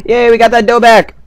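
high, loud yay of relief; excited cheer. Real, not acted. Gamer cheering over getting his bread back, excited. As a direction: celebrate the win out loud. Recovers lost bread in game.

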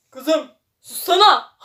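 slow, loud line broken by laughter; laughing mock threat. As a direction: try to growl it but crack up. Villain silencing girl, laughing. (gülmekten bozulan sahte tehdit)

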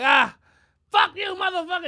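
loud, high furious shout; cursing out. Retold or imitated. Comedian cursing somebody out on the phone, mock furious. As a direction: re-enact a screaming phone fight. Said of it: "I was cursing somebody out".

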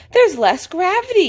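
put-on high, loud know-it-all child; eager high-pitched shout; excited yell. A narrator's reading. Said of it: "yells Edmund".

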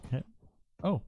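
ordinary talk

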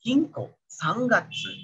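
"Ginko, Sangatsu" slowly with a held ng; n read as ng. Shown as a demonstration. Teacher drills the ng, drawn out in "Ginko, Sangatsu". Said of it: "eng gitu ya".

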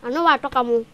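normal talking voice

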